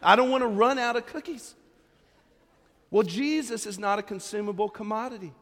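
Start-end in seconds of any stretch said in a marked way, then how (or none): 0.0-1.5 louder whiny cookie hoarder; half-hearted whining cookie hoarder; mock anxious hoarding. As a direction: fret about running out of cookies. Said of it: "So you're rationing them out".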